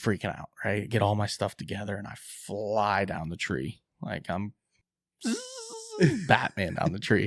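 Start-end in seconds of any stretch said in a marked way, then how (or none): 5.2-6.2 very high, thin zipping noise; imitated zipline whoosh. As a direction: mimic a fast slide down a line. Mimes zipping down the tree.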